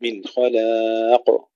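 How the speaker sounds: خلاق with a thick kha and a thin lam, slowly; heavy kha, light lam. Shown as a demonstration. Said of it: "تفخيم الخاء، ترقيق اللام". (تفخيم الخاء وترقيق اللام في خلاق)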